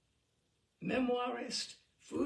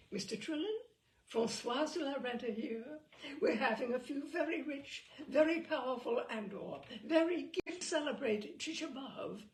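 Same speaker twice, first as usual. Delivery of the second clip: society hostess voice; 6 semitones higher than usual. Pretend. Imagined Mrs de la Renta calling.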